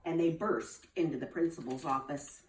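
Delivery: plain reading voice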